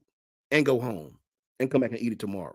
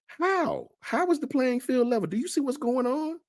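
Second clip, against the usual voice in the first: incredulous. Real, not acted. Mocking rival's level playing field claim.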